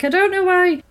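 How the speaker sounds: lightly mocking dad voice; imitating the dad. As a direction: whine it like a fed-up father. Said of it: "he's like".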